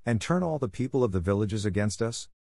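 ordinary delivery

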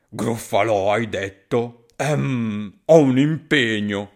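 a big beast suddenly scared; nervous monster. Acted. Gruffalo scared off by mouse.

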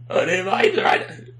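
high-pitched screech breathing in; high inward screech. Shown as a demonstration. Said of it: "I can do this really high-pitched s-, screech".

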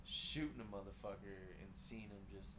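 ordinary talk

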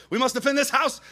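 high, loud rallying cry; hammed-up team war cry; Under Armour commercial. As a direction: shout it like a sports ad battle cry. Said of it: "Under Armour commercial".

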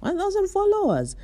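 high, squeaky disbelief; can't believe the follower count. Retold or imitated. Checking her Instagram, squeaking high in disbelief. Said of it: "And I'm like".

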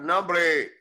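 exclamación fuerte y aguda de rechazo; rechazo enérgico. Real, not acted. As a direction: corta el lloriqueo con un grito de desdén. Rechaza el lloriqueo que imitó. (¡protesta en voz alta!)

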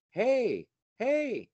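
put-on high, loud call of an old man; hammed-up old man hollering hey. Old man calls out on train.

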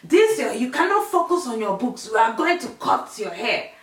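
exaggerated stern mum voice; scolding mum. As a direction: imitate your angry mum laying down the law. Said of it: "And she's like".

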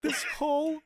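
high, slow, halting pleading voice; actor's impression. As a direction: plead slowly like a film actor in a hammed-up impression. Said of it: "wanna see an actor go".

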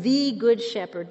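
"the" said as a strong THEE; stress on "the". Shown as a demonstration. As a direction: correct yourself by stressing "the". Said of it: "I want to emphasize the word "the".

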